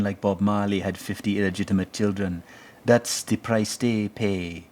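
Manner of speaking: put-on heavy, lilting Jamaican accent with stretched vowels. Show host riffing on the episode as a Jamaican stoner, in a heavy lilting Jamaican accent. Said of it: "I love doing that accent".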